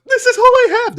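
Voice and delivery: very high, loud pleading voice; frightened older woman. Acted. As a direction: squeak it out, terrified. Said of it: "And this woman goes".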